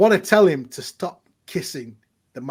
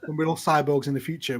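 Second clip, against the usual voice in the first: ordinary talk, nothing marked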